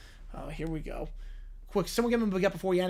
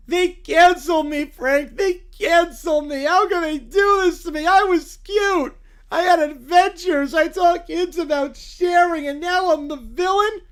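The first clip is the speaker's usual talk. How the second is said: higher, louder wailing self-pity; self-pitying whine; 6 semitones higher, 7 dB louder, slower than usual. Acted. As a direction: whine about the unfairness. The bear whines about being canceled.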